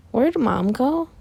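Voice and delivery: high, wavering-pitch question; puzzled sister. Acted. Izuka asks where Mom went, high and puzzled. Sister finds mother gone.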